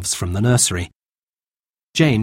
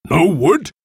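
higher, indignant chief's question; angry; 6 semitones higher, 4 dB louder than usual. A narrator's reading. Golden Eagle rounds on his men, angry. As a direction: repeat it with rising anger. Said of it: "Golden Eagle was angry".

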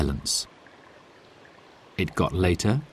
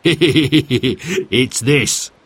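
still laughing; 4 semitones higher than usual. Acted. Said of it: "still laughing".

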